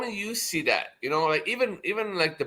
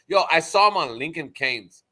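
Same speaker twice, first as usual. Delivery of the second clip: usual speaking voice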